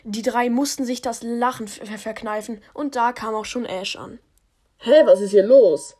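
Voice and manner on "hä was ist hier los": loud deep character voice; loud booming servant; Ash voice. Acted.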